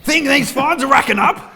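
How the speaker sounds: high, loud laughing yell; laughing mock yell. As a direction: shout it up at the baby through a laugh. Yelling at unborn baby.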